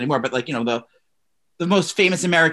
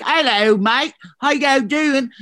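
mock British accent, pitched up; 8 semitones higher, much slower than usual. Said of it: "all of a sudden was talking like".

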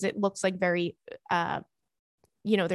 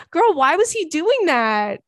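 higher, louder, slow disbelief; incredulous; 6 semitones higher, 5 dB louder, slower than usual. Real, not acted. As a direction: stretch it out in baffled amusement. Reacts to the husband on dog stairs.

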